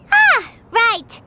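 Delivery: ordinary talk, nothing marked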